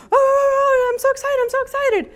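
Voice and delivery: very high, fast squeal of excitement; be a dog bouncing at the leash; overjoyed puppy squealing; giddy excited dog. Retold or imitated.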